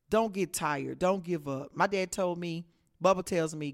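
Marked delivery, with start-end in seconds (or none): none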